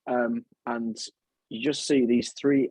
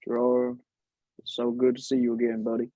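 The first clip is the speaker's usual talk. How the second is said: put-on Southern accent; faster than usual.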